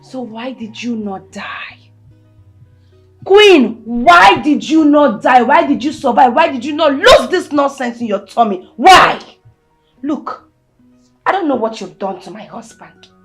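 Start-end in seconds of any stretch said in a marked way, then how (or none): 0.0-1.9 slow, hissed whisper of a threat; menacing whisper. Acted. Taunting the poisoned girl. 3.2-9.2 angry taunting. Acted. Taunting the poisoned girl.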